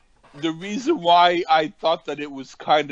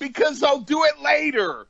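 much higher whiny mock voice; 12 semitones higher than usual. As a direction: whine it like a stubborn kid. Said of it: "He went".

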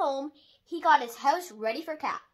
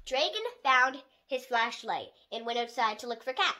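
ordinary delivery, nothing marked

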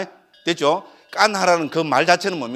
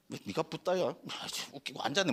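retold scoffing under the breath, much softer; insolent grumbling; 13 dB quieter than usual. Insolent youth sizing up the Buddha, mock grumbling. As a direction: imitate a dismissive grumble, very quietly. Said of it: "끌렁끌렁끌렁 있잖아요".

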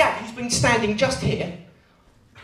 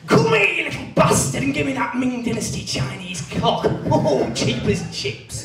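higher, chivvying antiques-dealer voice that fades to a laugh; David Dickinson impression; 4 semitones higher than usual. David Dickinson chivvying someone in the pub corner. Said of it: "David Dickinson chivvying someone up".

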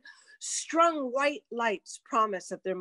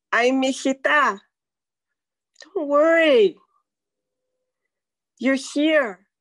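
a mother soothing her returning daughter, louder; fond reassurance; 3 semitones higher, 7 dB louder than usual. Retold or imitated. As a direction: tell her she's home at last. Mexican mom comforting daughter.

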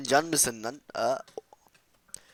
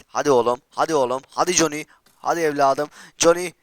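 egging on; 2 semitones higher, 8 dB louder than usual. Real, not acted. Urging on kills, cheering.

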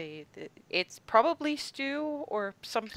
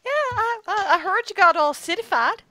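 teasing Lisa; 11 semitones higher, 7 dB louder than usual. Acted.

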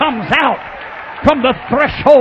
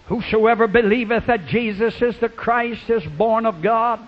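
softer, lower measured Bible reading; scripture reading; 3 semitones lower, 4 dB quieter than usual. Acted. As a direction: read the verses gently and evenly.

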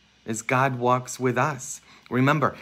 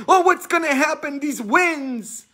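high, loud scared cry about the winds; mock frightened family; 3 dB louder than usual. Priest voicing a scared family in the storm, high and fearful. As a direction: play the panicked family.

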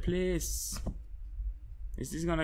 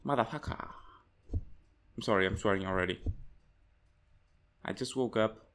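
ordinary talk, nothing marked

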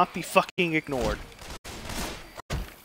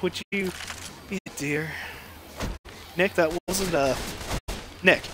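ordinary talk

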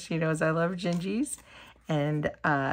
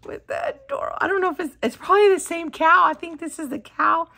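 high and loud, gushing over the cow; high excited squeal; 9 semitones higher, 7 dB louder, much faster than usual. Real, not acted. Crafter gushing over a cow box, squealing high and loud. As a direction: squeal louder as it builds.

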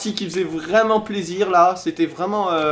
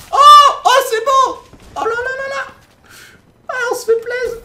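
much higher, delighted squeal; excited squeal; 9 dB louder than usual. Real, not acted. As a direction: squeal with joy at the play. Squealing at a great play.

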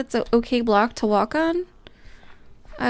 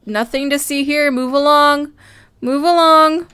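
loud, stern guard voice shooing people off; mock security guard; 3 semitones higher, 8 dB louder than usual. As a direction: play a bossy guard waving the crowd on. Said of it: "They're like".

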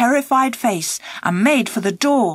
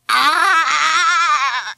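howl; 4 semitones higher than usual. Acted. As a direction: cry out like a man in agony. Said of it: "he howled".

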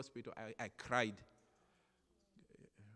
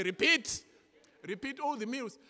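eager order; 10 semitones higher, 6 dB louder than usual. Retold or imitated. Eager repeat food order.

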